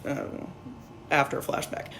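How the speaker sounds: ordinary talk, nothing marked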